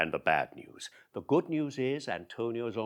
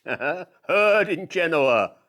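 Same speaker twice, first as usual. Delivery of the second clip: slow, laughing relish; gloating laugh; 6 semitones higher, 11 dB louder, much slower than usual. Acted. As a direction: savor Antonio's ruin. Gleeful Shylock.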